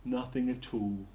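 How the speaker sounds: slow, soft, dismal intoning; Marvin, dismally. A narrator's reading. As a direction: intone it like a depressive robot. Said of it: "intoned Marvin dismally".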